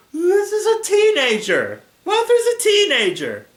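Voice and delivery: high, loud outburst; loud, high-pitched objection; exclaiming in protest. Real, not acted. Reader's outraged aside at story.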